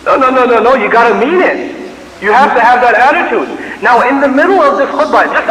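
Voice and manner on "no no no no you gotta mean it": usual speaking voice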